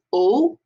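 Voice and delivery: "O" as a pure, round "oh"; rounded French O. Shown as a demonstration. Teacher demonstrating mouth shape, round pure "O". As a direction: round the lips like a donut hole for "O". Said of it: "like the hole of a donut".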